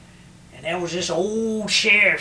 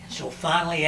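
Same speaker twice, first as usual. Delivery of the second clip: usual speaking voice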